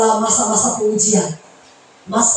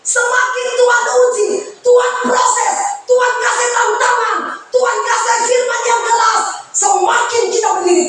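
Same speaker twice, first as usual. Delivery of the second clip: high, sung preacher's call; preaching at full force; 10 semitones higher than usual. Acted. Pastor urges believers to stand firm, high sung preaching. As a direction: stretch the words out like a hymn.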